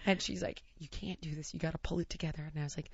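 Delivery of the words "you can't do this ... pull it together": much softer, lower firm hush; hushed scolding. Retold or imitated. Heidi warning her partner under the chef's eye, hushed firm scolding. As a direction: scold under your breath.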